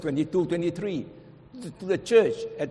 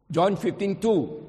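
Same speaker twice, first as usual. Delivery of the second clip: ordinary delivery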